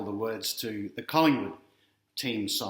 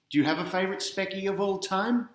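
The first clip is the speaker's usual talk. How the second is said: plain reading voice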